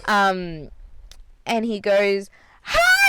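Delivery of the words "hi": put-on high, loud toddler greeting; excited yell. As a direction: shout it like a delighted little kid. Toddler nephew calls out to her.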